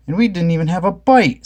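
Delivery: a hint of grumbling Fred; Fred complaining. A narrator's reading. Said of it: "said Fred".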